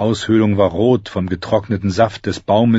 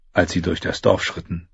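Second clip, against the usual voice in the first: ordinary delivery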